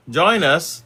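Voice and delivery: ordinary delivery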